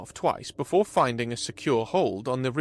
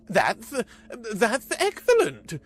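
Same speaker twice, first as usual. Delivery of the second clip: high, louder, stumbling surprise; surprised; 9 semitones higher, 4 dB louder, slower than usual. A narrator's reading. Vrodax surprised by Carlos's deal, high stammer. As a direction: stammer out grudging delight. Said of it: "Vrodax admitted, surprised".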